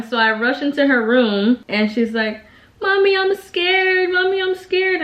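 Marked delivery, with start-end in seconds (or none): 2.8-5.0 imitated scared child. Frightened daughter calls her mother into her room, high mock wailing. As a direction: plead like a frightened little girl. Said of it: "she just starts crying".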